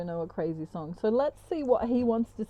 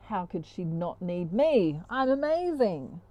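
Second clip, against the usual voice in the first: mock egotism; slower than usual. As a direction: boast like a wounded ego. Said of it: "he's in his ego".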